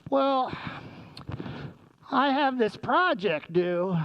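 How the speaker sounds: put-on high, slow, stalling kid voice; hesitant seventh grader. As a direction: confess it slowly, like a kid with bad news. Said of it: "from your seventh grade son or daughter".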